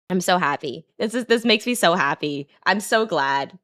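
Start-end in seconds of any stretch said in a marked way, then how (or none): none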